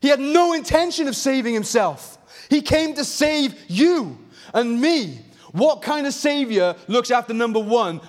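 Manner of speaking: preacher at full force; impassioned preaching. Real, not acted. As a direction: drive the point home to the congregation.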